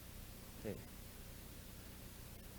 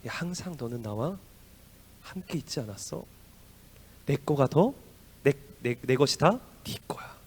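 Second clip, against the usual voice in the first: gentle father. Acted. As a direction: speak as a father gently to his son. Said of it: "아버지 뭐라고 해요?".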